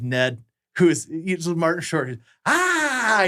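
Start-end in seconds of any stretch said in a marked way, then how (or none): 2.5-3.2 Ned's yelp. Retold or imitated. Ned yelps, gun tossed around.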